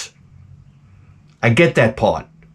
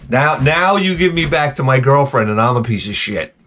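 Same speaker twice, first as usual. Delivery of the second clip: ordinary delivery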